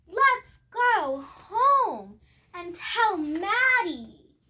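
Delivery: mother speaking. A narrator's reading. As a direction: call out to the family, unhurried. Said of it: "The mother said".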